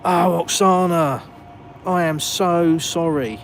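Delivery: loud, slow, dismayed apology; horrified realisation. Real, not acted. Vlogger apologising to his teacher Oksana, loud dismayed cry. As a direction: caught out, the shock spills into an apology. Reminder says he is late.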